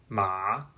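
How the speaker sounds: ma dipping low then rising; third tone ma. Shown as a demonstration. Teacher shows the dipping third tone. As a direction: dip ma down and bring it back up. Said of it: "the third tone is a dipping sound".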